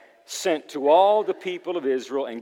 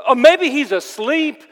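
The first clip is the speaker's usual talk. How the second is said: high, loud mock speculation; hammed-up taunting suggestion; 13 semitones higher, 10 dB louder than usual. Elijah mocking the prophets' silent god, loud and taunting. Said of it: "Elijah began to mock them".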